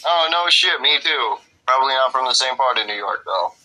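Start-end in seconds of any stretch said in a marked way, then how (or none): none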